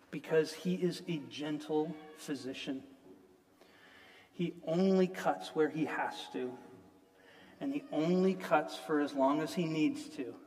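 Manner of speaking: quiet, earnest plea to the congregation; soft pleading sermon. Acted. Preacher softly pleading with the congregation, quiet and earnest. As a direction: softly urge them to listen.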